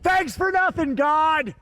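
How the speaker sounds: high, yelled sarcastic thanks; hammed-up exasperation; mock-angry complaint. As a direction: yell your grievance at the heavens. Mock complaint after failed stunt.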